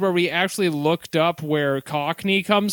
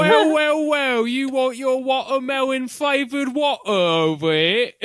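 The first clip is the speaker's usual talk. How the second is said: higher, hammed-up Cockney ragamuffin; 8 semitones higher, slower than usual. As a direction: do a cartoon Cockney chimney sweep. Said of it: "that's the lovable Cockney chimney sweep".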